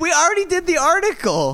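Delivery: high-pitched, incredulous complaint; exasperated protest. Real, not acted. Exasperated at article having more parts.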